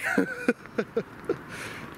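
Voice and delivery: airy unvoiced giggle; real amused laugh; breathy high laugh. Real, not acted. Laughs after the French phrase.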